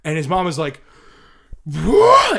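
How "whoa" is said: high loud outraged whoa; mom erupting. Retold or imitated. Mom rounding the corner, high furious whoa. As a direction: burst out at him. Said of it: "and just erupts".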